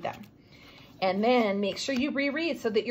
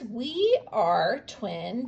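slow reading aloud; much slower than usual. Shown as a demonstration. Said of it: "so here's".

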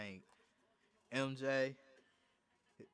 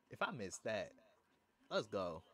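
normal talking voice

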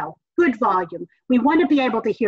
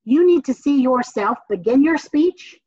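ordinary talk

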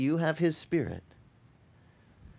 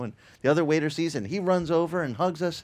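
ordinary talk

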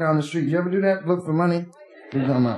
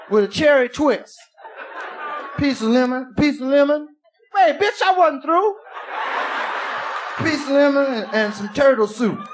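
big spender hollering at the waitress; 6 semitones higher than usual. Acted. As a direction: shout the order over the crowd. Acts out drunk ordering in bar.

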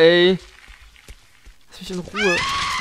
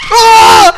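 sudden high shriek of fright; terrified scream; 16 dB louder than usual. Real, not acted. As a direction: scream in real terror. Jumpscare in horror game.

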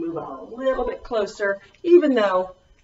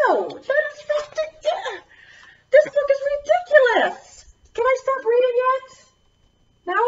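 much higher, laughing protest; mock exasperated. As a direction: whine that the book is ridiculous. Reader protests the silly book.

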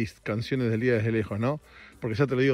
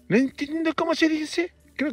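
mucho más agudo, voz fingida y perpleja; voz aguda de desconcierto. Acted. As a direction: finge no entender, en voz alta y chillona. Duda exagerada sobre la palabra. (voz aguda y desconcertada, como de personaje)

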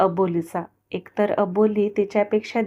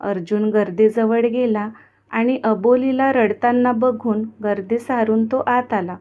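plain reading voice

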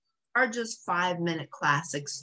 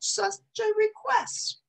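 high, slow Southern gentleman; courtly old hound. Acted. Trusty gladly agrees to help Lady, courtly. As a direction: drawl it out graciously.

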